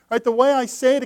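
ordinary delivery

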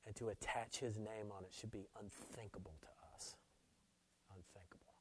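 very soft, low pulpit hush; quiet, grave preacher's voice; hushed, reverent preaching. Real, not acted. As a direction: drop almost to a whisper in reverence.

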